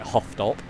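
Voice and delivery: "Hoofddorp" said as "HOFF-dorp"; locals' pronunciation of Hoofddorp. Shown as a demonstration. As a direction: say "Hoofddorp" with a short o in the first syllable. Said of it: "I think people were pronouncing it".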